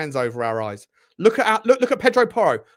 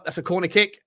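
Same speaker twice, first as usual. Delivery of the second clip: ordinary talk, nothing marked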